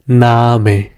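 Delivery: "Name" with a drawn-out ah; long A. Shown as a demonstration. Teacher showing learners the long vowel, held A in "Name". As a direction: stretch the A in "Name": naah-meh. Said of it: "as a long A".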